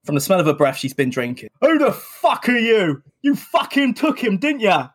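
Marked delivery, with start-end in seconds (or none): 1.6-4.9 put-on high, slow drunken snarl; leery angry. Drunken Delia accuses the dog walker, leery angry voice. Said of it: "Quite leery, quite angry".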